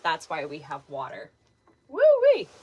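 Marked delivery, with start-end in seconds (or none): 1.9-2.5 high, loud breathless whoop. Real, not acted. Host catching breath after running, loud high whoop. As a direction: let out a winded woo-wee.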